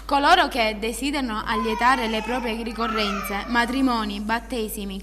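announcer read. Acted. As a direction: read it like a radio ad. Reads booking ad with phone numbers.

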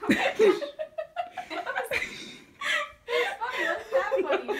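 loud, high, laughing through the words. Real, not acted. Actors breaking character on set, laughing loud. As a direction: crack up mid-line.

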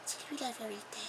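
put-on very soft English-accented French. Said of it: "Comment ça, t'as l'accent anglais?". (murmure à l'accent anglais)

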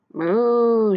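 loud, drawn-out moo; cow moo. A narrator's reading. Cow clambering onto the tractor, mooing. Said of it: "said Cow".

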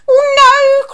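high, loud storybook wail; alarmed cry. A narrator's reading. Witch fleeing the dragon, alarmed cry. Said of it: "cried the witch".

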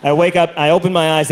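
normal talking voice

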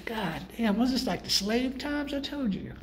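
usual speaking voice

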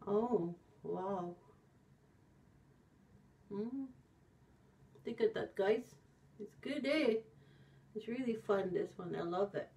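lips under wet mask; mask-covered mouth speaking; through a sheet mask. Real, not acted. Seen 0: Pineapple sheet mask covers face while speaking, adjusting it.

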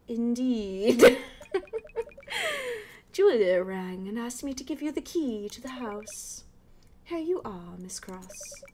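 posh British lady with an eerie laugh; a proper British neighbor, spooky giggle. Acted. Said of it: "like, a posh British voice".

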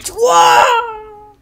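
long, high, loud wail; falling yell. Acted. Figure chucked off the building, loud falling yell. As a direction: wail like someone flung away.

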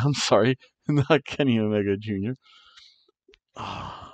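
usual speaking voice

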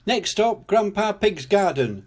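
high conductor-style announcement; announcing. A narrator's reading. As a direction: announce the next stop, pitched high. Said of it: "announced Grampy Rabbit".

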